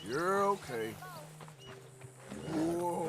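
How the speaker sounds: soft calming voice for a horse; soothing. Real, not acted. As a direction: gently settle a spooked animal.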